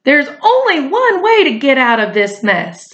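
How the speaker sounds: rally the friends with a high cry; shouted call. Acted. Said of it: "shouted out".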